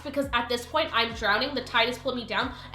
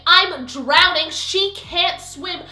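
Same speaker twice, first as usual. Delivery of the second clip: higher, louder, slowly drawn out; drawn-out desperate retold scream; screaming for help; 5 semitones higher, 7 dB louder, much slower than usual. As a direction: shout it across the water. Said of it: "literally screaming".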